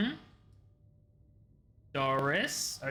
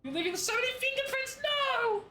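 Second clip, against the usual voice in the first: very high, frantic wail; squealed protest ending in a loud no; alarmed squeal; 3 dB louder than usual. Pretend. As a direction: cry out in mock panic. Panics over fingerprints in game.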